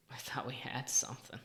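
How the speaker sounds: disappointed whisper to himself. Real, not acted. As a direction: breathe it out, defeated. Defeated after failed fix.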